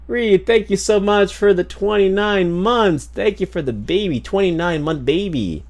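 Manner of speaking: high, celebratory subscriber thank-you; excited thanks. Real, not acted.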